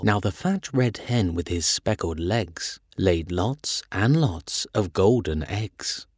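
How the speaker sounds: plain reading voice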